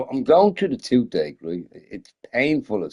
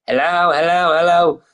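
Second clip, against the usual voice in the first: calling out; 5 semitones higher, 4 dB louder than usual. Retold or imitated. Dude calling into party chat.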